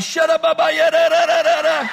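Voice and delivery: high, loud frantic gibberish; panicked babbling. Acted. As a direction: babble hysterically at the top of your voice. Acting panicked babble in his seat.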